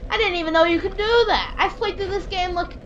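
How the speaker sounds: high-pitched, astonished outburst; surprised. Real, not acted. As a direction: exclaim it in high disbelief. Startled by unknown enemy move.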